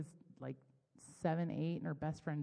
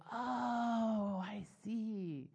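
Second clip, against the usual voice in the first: dawning realization; 4 semitones higher, much slower than usual. Acted. Believers reaching heaven and finally understanding, dawning realization. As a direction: let the understanding slowly dawn.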